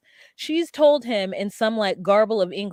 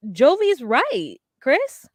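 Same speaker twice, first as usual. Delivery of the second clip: mock siding; 8 semitones higher, 5 dB louder, much slower than usual. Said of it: "And I'm like".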